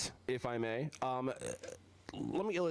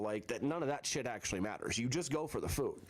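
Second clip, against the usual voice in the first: ordinary delivery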